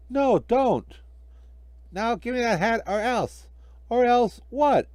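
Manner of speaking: high, loud bickering; squabbling voices. Acted. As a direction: snap back and forth over the hat. Squabble over the hat.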